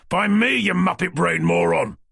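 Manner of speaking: loud, high furious insult; angry shout. Acted. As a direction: bellow the insult at him. Shouting ID at mugger.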